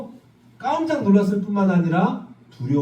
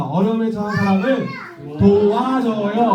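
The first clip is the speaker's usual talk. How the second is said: "도와줘요" drawn out slowly; slow call to repeat; 3 semitones higher than usual. Shown as a demonstration. Pastor leading kids to repeat, slowly. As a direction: stretch "도와줘요" so children can echo it. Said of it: "따라합시다".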